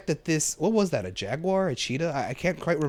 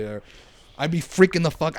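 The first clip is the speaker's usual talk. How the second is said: ordinary talk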